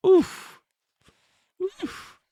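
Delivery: high squeaky laughing oof. Real, not acted. Streamer reacting to crypto losses with a squeaky laugh. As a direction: wince through the laughter. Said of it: "I don't know why I'm laughing".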